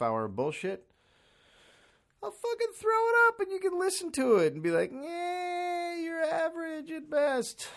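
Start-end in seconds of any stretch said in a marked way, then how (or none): 2.2-7.7 high, teary mock voice of a bored listener; mock whiny listener. Podcast host mocking his listeners, high crying voice. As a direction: whimper it like a disappointed fan.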